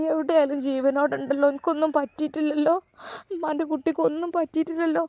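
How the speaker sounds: put-on tearful old mother. Mother questions son.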